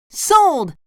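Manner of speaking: high, eager cartoon voice; SpongeBob. A narrator's reading. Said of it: "SpongeBob said".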